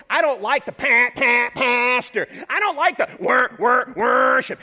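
loud, high spoiled churchgoer whine; whiny complainer. Retold or imitated. Churchgoer complaining about the church, loud mock whine. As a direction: whine like a demanding brat. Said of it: "It's so easy to go".

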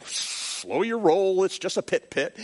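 slow, unbothered reply; drawn-out brushing off; Bill telling the speaker to calm down; calm-down retort. Retold or imitated. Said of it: "Bill's gonna say".

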